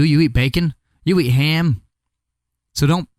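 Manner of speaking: ordinary delivery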